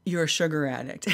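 lightly imitated deadpan trainer; a hint of the matter-of-fact trainer; understated trainer imitation. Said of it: "That's how he talked, like, just like".

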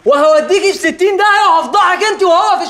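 husband threatens to shout at his wife. Acted. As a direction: raise the voice, half laughing. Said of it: "هعلي صوتي".

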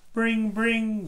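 light sing-out of a bell; lightly imitated doorbell chime; doorbell imitation. A narrator's reading. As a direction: ring it out on a held note. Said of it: "goes the doorbell".

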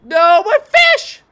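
high, loud, drawn-out wail of loss; anguished cry. Real, not acted. Gamer wailing at losing his fish, loud and high.

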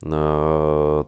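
ordinary delivery

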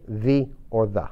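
"the" first as "thee", then "the" as "thuh"; two ways of saying the. Shown as a demonstration. Teacher repeating both ways to say the. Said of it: "Lo mismo con T H E".

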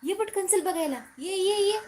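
loud, high call to come quickly; eager calling. Real, not acted. Calling child to see cat.

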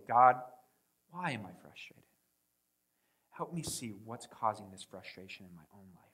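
soft, low inward prayer to God; quiet prayer. Retold or imitated. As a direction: murmur it as a private plea. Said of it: "we turn in and go".

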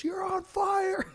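high, slow yelled warning; mock shout. Friend yelling from the Can-Am, high slow shout. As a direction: yell it up high, stretched out. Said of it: "He goes".

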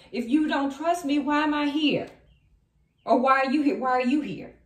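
heated acted challenge; indignant arguing. Voices Empress arguing back.

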